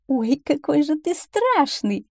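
high, slow teasing fox voice; amused mocking fox. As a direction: make fun of the little one, drawn out. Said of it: "засмеялась лисица". (насмешливая лиса, высоко и протяжно)

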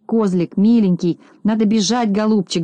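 ordinary delivery

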